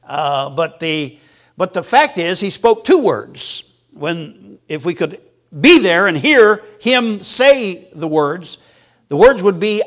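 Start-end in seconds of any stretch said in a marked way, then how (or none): none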